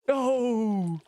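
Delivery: high panicked wail, slow on fire line; mock panic. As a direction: shriek it like a hammed-up disaster victim. Mock panic over burning house.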